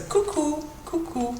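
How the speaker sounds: high, light little peekaboo call; cute coucou. Shown as a demonstration. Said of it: "just a cute little kind of".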